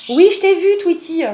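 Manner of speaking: high, loud pet-talk; high cooing at the cat. Real, not acted. Calling to her locked-out cat in high pet-talk. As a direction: sing it up to the cat through the door.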